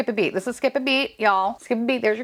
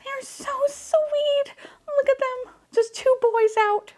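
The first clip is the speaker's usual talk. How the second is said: doting coo; slower than usual. Real, not acted. Collector doting on the two boys, high sweet coo. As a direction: gush over them in a tiny high voice. Cooing over two figures.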